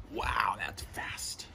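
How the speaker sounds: whispered wow, slow and hushed; awed whisper. Acted. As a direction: breathe it out in hushed amazement. Awed reaction to speed of light.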